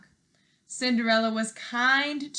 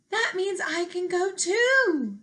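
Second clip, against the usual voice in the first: hopeful Cinderella; 9 semitones higher, 4 dB louder than usual. Acted.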